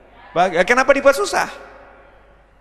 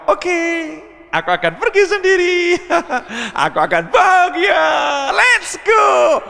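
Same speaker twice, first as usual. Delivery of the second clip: hammed-up happy wife going alone; mock cheerful wife; 11 semitones higher than usual. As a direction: shout it high like a wife who won't be spoiled.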